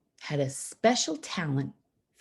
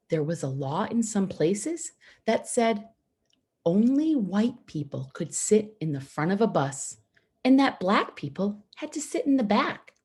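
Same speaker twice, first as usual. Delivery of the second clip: ordinary delivery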